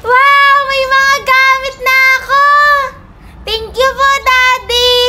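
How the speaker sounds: high, singsong excitement; thrilled, lilting thanks; delighted gratitude. Acted. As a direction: gush over new presents. Child sees new school supplies.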